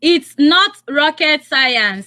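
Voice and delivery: slow and loud, each word set apart; slow quiz prompt. Shown as a demonstration. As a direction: hammer the phrase out for the caller. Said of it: "How would you say it in Pidgin English?".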